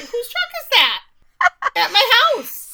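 high, loud cartoonish disbelief; mock incredulity. Voicing the husband's missed reaction.

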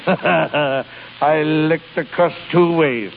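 slow, chuckling triumph; gloating chuckle. Acted. Boss gloats to Skinner about beating the rival, chuckling. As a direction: savour the win between chuckles.

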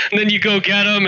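ordinary delivery

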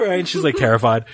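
ordinary delivery

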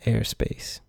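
soft whispered joke. Pretend. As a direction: breathe the words almost silently. Joking whispered list.

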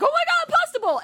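very high, loud gushing squeal; mock excited. Worker mimics Olive Garden customers' joy, mock excited. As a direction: imitate an overexcited customer. Voicing thrilled customers, mocking them.

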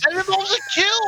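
high, loud protest; shouted objection. Real, not acted. Co-host objects to the scene pick, shouted objection. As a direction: shout it as an outraged interruption.

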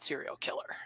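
whispered relief; whispering, relieved. Retold or imitated. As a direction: breathe it out as a secret.

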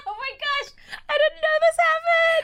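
very high, loud squeal of disbelief; amused shock. Real, not acted. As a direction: squeal it out in real astonishment. Friend reacts shocked to the story.

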